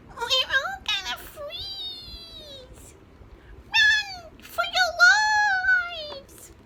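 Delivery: very high, very slow, loud chick wail; panicked squeal. A narrator's reading. Smallest chicken panics at the cold, high wailing shriek.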